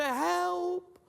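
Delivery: high, drawn-out calls for help; desperate cries for help. Pretend. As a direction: cry up from the hole, desperate. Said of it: "You resort back to".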